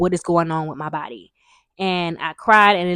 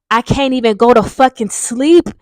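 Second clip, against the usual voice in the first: mildly exasperated outburst, higher and louder; retold exasperation; 4 semitones higher, 6 dB louder than usual. Woman retelling her sleepless night with eye pain, exasperated. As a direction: re-enact fed-up frustration, pitched up and louder.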